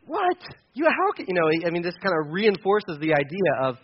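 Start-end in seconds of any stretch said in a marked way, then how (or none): 0.1-1.3 high, startled mock disbelief; amazed. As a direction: jump up in pitch at the shock. [name] amazed by modern mirrors.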